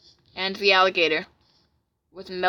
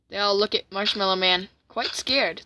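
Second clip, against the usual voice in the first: plain reading voice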